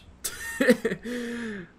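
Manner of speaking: disbelieving laugh; high burst of laughter. Real, not acted. Stung by harsh chat.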